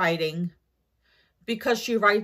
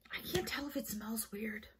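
hushed whisper; 7 semitones lower, 22 dB quieter than usual. Real, not acted. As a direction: whisper it to yourself. Sniffing product, whispering.

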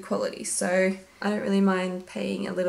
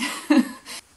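giggle; 5 semitones higher, 6 dB louder than usual. Real, not acted. Giggling over koala pajamas.

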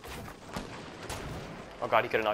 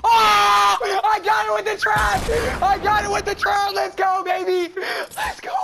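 shrieking jackpot winner; 7 dB louder than usual. Real, not acted. Trap kill celebration.

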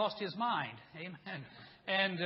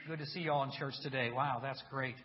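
ordinary talk, nothing marked

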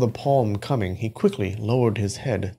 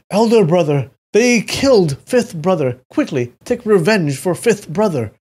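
high, loud, urgent cry; calling out; 8 semitones higher, 6 dB louder than usual. A narrator's reading. Opera actor to elder brother about a killing, calling out. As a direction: call out loudly for revenge, voice raised. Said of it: "The opera actor called out".

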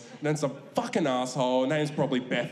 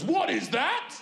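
suspicious dad; 12 semitones higher, slower than usual. Retold or imitated. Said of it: "my dad goes".

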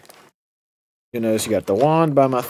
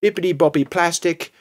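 mock spell; 6 semitones higher than usual. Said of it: "Let's cast another spell".

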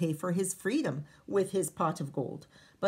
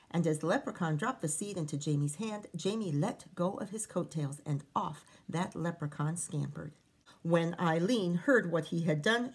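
usual speaking voice

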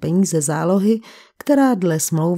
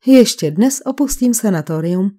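usual speaking voice

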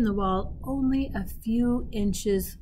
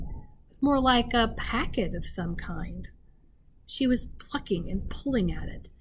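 ordinary delivery, nothing marked

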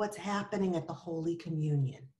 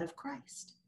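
hushed; 7 semitones higher, 13 dB quieter than usual. Acted. Reverent hushed close.

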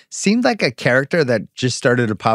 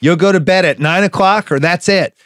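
mock parental ultimatum, pitched up; stern parent; 7 semitones higher, 4 dB louder than usual. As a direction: lay down the law like a scolding parent. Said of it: "when I talk to my kids".

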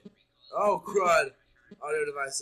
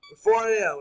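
emphatic time call; 4 semitones higher, 9 dB louder than usual. Real, not acted. Relief at the hour.

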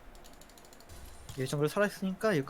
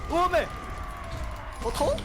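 high startled cry; surprised yelp; 12 semitones higher, slower than usual. Real, not acted. Sudden fight, surprised shout. (깜짝 놀라 외치며)

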